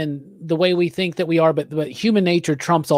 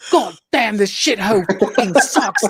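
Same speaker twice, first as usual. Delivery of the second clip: raised, clipped British merc voice with a laugh breaking through; uptight British mercenary; 2 semitones higher than usual. Retold or imitated. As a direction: sneer like a stuck-up British soldier of fortune. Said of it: "the British guy who has just gotta stick up his ass".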